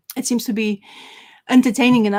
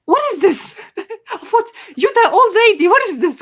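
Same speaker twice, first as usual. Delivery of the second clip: higher, louder, with a laugh breaking in; stunned disbelief; 11 semitones higher, 4 dB louder than usual. Retold or imitated. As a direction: gasp in amused shock at the old lady. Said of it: "And he's like".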